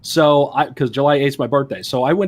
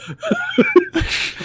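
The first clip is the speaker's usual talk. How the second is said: a high burst of laughter. Real, not acted. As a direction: crack up at the punchline. Laughing at his own retelling.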